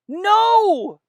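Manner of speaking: very high, loud distressed refusal; upset. Acted. Drummer boy refusing to go home, very high loud upset cry. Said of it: "He sounded more and more upset".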